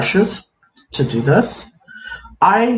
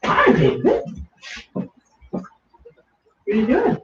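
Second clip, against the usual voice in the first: high sing-song hi; cheery greeting; 10 semitones higher, 5 dB quieter than usual. Real, not acted. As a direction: greet him in a lifted, playful voice. Greeting a viewer in chat.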